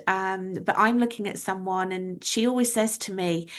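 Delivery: usual speaking voice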